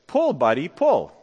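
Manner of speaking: easy, understated call to a horse; nonchalant. Acted. Farmer to his horse Buddy, nonchalantly, no yelling. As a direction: say it casually, without pushing. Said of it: "the farmer nonchalantly said".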